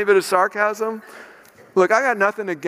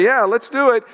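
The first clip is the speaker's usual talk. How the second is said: a hint of a gushing crowd, higher and brighter; mock eager agreement; 6 semitones higher, 4 dB louder than usual. Preacher mocks the crowd cheering [name]'s plan, in a mock-eager voice. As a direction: lightly ham up naive enthusiasm.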